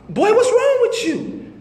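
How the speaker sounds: high-pitched mother voice, alarmed; worried mother. Retold or imitated. Mother alarmed by son's late-night call, high worried voice. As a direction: play the mom, shrill with alarm.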